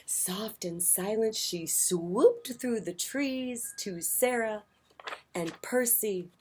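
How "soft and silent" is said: plain reading voice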